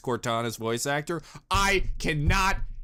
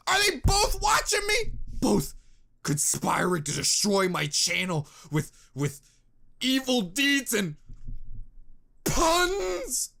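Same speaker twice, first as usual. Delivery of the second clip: mock paranoia; 8 semitones higher than usual. Accusing Bernie and Barbara of a pun plot. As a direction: rise into a fake-paranoid shout.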